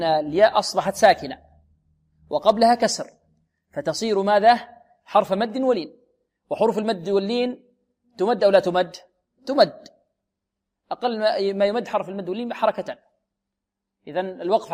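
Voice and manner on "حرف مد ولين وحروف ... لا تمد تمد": ordinary talk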